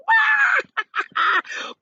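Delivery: high, loud shrieking laugh; hysterical laugh. A narrator's reading. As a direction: collapse into hysterics. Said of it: "sent the centipede into hysterics".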